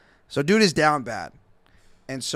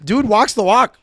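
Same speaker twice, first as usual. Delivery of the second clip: hyped-up praise; 8 semitones higher, 9 dB louder than usual. Real, not acted.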